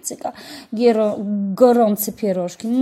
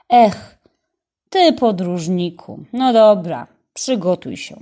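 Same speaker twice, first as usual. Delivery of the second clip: louder, laughing big-brother tone; hearty loud brother; 7 dB louder than usual. A narrator's reading. Older brother teasing the boy, loud and hearty. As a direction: clap him on the shoulder with your voice.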